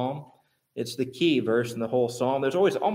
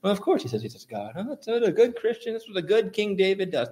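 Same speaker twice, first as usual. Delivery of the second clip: quick mock reaction, jumping higher; glib dismissal; 5 semitones higher, faster than usual. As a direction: toss it off like a listener skimming past. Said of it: "language you would pass over".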